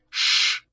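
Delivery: breathy hushing sound; unvoiced "shh"; sharp shush. A narrator's reading. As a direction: hush the child while hiding. Father hushes son in hiding.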